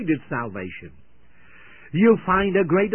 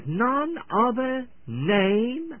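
"none other name" slow, high and rising at the end; drawn-out verse reading; 6 semitones higher, much slower than usual. Shown as a demonstration. Preacher reading scripture to the unsaved, slow and lifted.

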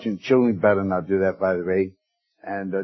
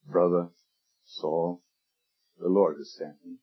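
much softer and lower, a tender welcome; tender greeting; 6 semitones lower, 8 dB quieter than usual. Retold or imitated. Ananias embracing former persecutor Saul, soft and tender. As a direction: greet him gently, arms around him.

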